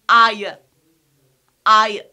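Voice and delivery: "I" loud, gliding into a short schwa, "eye-uh"; triphthong pronunciation. Shown as a demonstration. Teacher showing how we pronounce it, "I" as "eye-uh". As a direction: say "I" as eye plus weak uh. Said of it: "We pronounce it as".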